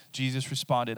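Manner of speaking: normal talking voice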